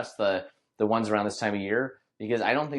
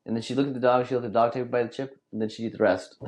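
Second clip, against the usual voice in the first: normal talking voice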